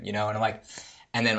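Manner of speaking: ordinary delivery, nothing marked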